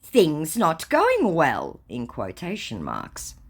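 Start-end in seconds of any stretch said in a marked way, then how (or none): 0.0-1.8 scammer voice. Retold or imitated. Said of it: "To which he says".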